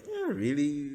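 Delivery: normal talking voice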